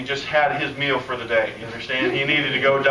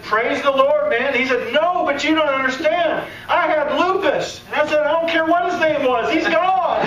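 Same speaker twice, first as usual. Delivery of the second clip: much higher, louder, rejoicing; preacher rejoicing at the healing, laughing; joyful preaching; 13 semitones higher, 5 dB louder than usual. Real, not acted. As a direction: shout for joy, laughing toward the end.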